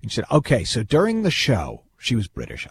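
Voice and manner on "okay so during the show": stage manager in a mock Jersey accent, bored and a bit higher. Stage manager briefing phobic guests, mock bored Jersey accent. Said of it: "giving her a sort of a Jersey accent".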